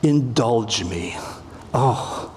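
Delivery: slow, low, self-indulgent plea; a lounging lover asking to be pampered; languorous indulge me. Shown as a demonstration. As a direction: sink lazily into it. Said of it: "Languorous, self-indulgent".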